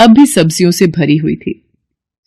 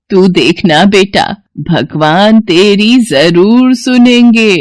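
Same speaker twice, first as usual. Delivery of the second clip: put-on slow, kindly old woman's blessing, rising at the end; slower than usual. Old woman blesses Somu and his wife, slow and kindly. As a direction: bless him slowly like a grateful grandmother. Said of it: "और फिर सोमू से कहती है".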